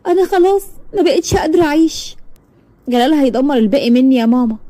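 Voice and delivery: high, tearful hopelessness; girl sobbing to her mother, high and broken; crying despair. Acted. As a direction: say it through tears, wanting to give up. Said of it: "فضلت تعيط جامد".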